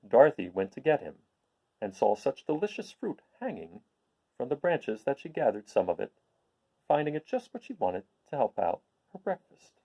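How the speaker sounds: put-on expressive storyteller reading a children's classic; story narration. A narrator's reading. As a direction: read it like a storybook to listeners. Reads the Oz story aloud.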